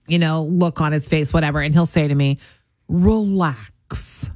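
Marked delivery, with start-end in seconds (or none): 2.9-4.3 drawn-out, deadpan husband's relax; smug, slow, unbothered relax. Retold or imitated. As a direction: imitate a smug husband, stretching the word out. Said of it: "he's got this smug".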